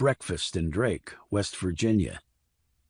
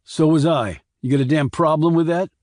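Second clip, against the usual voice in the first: louder, higher defensive challenge; angry retort; 6 semitones higher, 5 dB louder than usual. A narrator's reading. Randy snaps at his sister over the gravesite, louder and higher. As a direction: push back hard at her.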